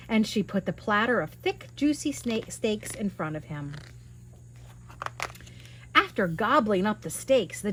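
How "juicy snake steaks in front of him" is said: ordinary delivery, nothing marked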